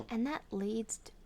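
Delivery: ordinary delivery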